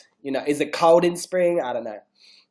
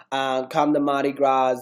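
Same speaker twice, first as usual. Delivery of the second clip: usual speaking voice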